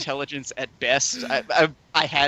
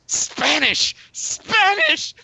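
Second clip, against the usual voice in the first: mock outraged shrieking, much higher; offended outburst; much slower than usual. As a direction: shriek it like an insulted snob. Girl outraged at Spanish mention.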